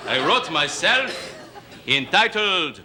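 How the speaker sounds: light mock French crooner voice; hint of a hammy French singer; fake French accent. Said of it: "the great fake French singer".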